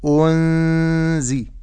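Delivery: أنزي very slow, nun hidden in nasal ghunna; correct ikhfa. Shown as a demonstration. Teacher modelling the correct hidden nun. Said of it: "فأقول".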